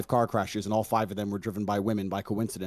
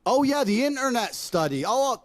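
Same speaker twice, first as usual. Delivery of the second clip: much higher, mocking sing-song sarcasm; sarcastic mockery; 12 semitones higher, 3 dB louder than usual. Mocking opponent's internet study argument, sarcastic high voice. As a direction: sneer at the idea with high mock voice.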